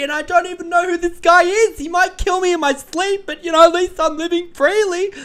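very high, loud, mock teary rapture; very high hammed-up weepy gush; tearful giddy daughter. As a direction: squeal through mock tears of freedom. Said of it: "She's like".

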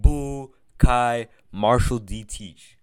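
slow, syllable by syllable; over enunciating. Shown as a demonstration. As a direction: spell the name out slowly. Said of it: "Let me say it slowly".

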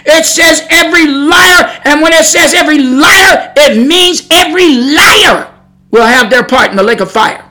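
loud, forceful pulpit delivery; fiery preaching. Real, not acted. Preacher rebuking lying preachers with God's word, fiery preaching. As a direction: preach it hard, stretching key words.

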